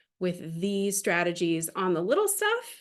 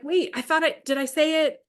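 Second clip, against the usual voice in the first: confused surprise; 8 semitones higher, 4 dB louder than usual. Retold or imitated. Dad confused when it fails.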